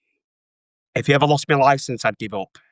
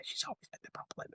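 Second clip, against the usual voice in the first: under-the-breath whisper; muttered whisper of blame; whispered aside; 24 dB quieter, faster than usual. Retold or imitated. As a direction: whisper it to yourself. Repeats line under breath.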